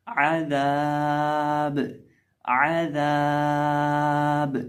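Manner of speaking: आज़ाब ending in a silent बा, very slow; pausal form. Shown as a demonstration. Teacher demonstrates the pause for students, drawn out. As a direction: stop on a still बा in आज़ाब. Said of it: "वक़फ़ करेंगे".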